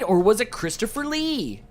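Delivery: ordinary talk, nothing marked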